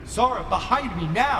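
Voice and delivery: high, urgent order to get behind him; a protector barking at them to take cover; high urgent warning call; urgent command. Acted. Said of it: "Sara stays put".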